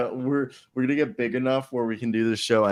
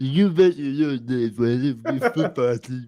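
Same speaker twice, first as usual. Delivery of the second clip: slow, slurred coach impression; hammed-up mush-mouthed voice; Lou Holtz; 3 semitones higher, slower than usual. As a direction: garble the words like a caricatured football coach. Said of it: "Lou Holtz sounds like that".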